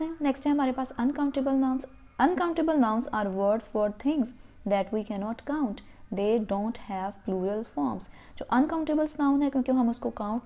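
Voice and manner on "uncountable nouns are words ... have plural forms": very slow, word by word; slow textbook reading for pupils; slow reading of a rule. Shown as a demonstration. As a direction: read the rule out slowly for dictation. Teacher reading the rule aloud.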